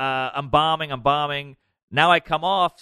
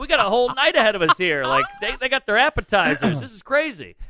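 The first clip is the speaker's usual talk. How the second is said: high, loud, stammering disbelief; incredulous protest; 9 semitones higher, 5 dB louder than usual. Retold or imitated. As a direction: reenact a stunned, agitated objection. Retold disbelief at being fired.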